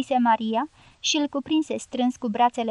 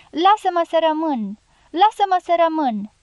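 higher, louder desperate plea; narrated pleading cry; pleading shout; 5 semitones higher, 4 dB louder than usual. A narrator's reading. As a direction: beg to stay behind, calling out. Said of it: "strigă Maria".

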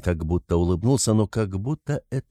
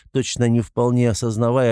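ordinary delivery, nothing marked

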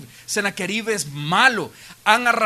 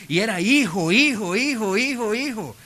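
singsong chain of one repeated word; rattled-off repetition; 3 semitones higher than usual. Retold or imitated. Preacher counting off Penina's endless babies, rolling repetition. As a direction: pile them up in a rolling rhythm. Mimics children born one after another.

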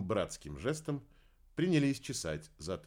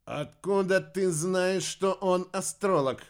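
loud, higher, slow abrupt question; curt question; 9 semitones higher, 7 dB louder, slower than usual. A narrator's reading. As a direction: demand the answer curtly. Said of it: "отрывисто спросил Кайл". (отрывисто и громко, с раздражением)

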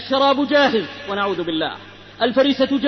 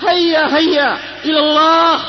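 raised, higher rallying call; urgent exhortation; 4 semitones higher, 4 dB louder than usual. Real, not acted. Preacher urging youth to hurry to God, urgent call. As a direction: call out to spur them on.

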